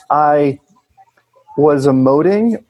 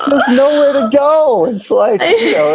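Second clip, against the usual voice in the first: excited; 11 semitones higher than usual. Real, not acted. Laughing excited emphasis with guest.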